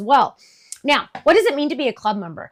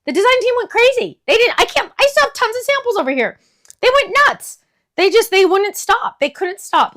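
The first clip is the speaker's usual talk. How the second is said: excited rave; 10 semitones higher, 4 dB louder than usual. Real, not acted. Raving about the design team.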